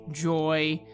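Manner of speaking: normal talking voice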